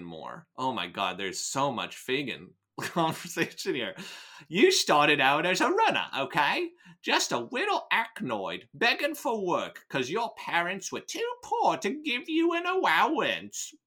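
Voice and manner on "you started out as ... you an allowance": Cockney sliding into Daffy Duck, pitched high; cartoonish Cockney. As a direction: play up the Cockney till it turns Elmer Fudd. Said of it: "like, a Daffy Duck".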